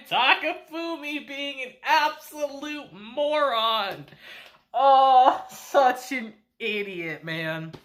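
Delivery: high, drawn-out words through real laughter; laughing exasperation, stretched and high; helpless laughter. Real, not acted. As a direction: crack up while calling someone a fool. Cracking up at character's stupidity.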